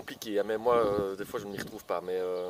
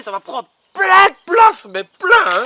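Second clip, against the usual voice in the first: much higher and louder exclamation; excited shout; 12 semitones higher, 14 dB louder, slower than usual. Real, not acted. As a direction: burst out to show how huge it gets. Exclaims plant grows huge.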